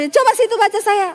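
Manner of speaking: high-pitched, pushy imitation of her; rude challenge. Troubled woman challenges the counselor, rudely. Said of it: "ini orang gila belum begini nih".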